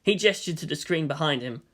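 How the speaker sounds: plain reading voice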